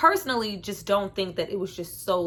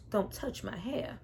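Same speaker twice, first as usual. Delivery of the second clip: soft slow mock Eddie; 7 dB quieter than usual. Quoting Eddie Griffin.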